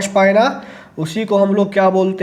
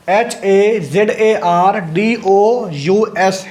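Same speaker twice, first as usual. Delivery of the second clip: spelled out slowly; much slower than usual. Shown as a demonstration. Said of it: "spelling out the word".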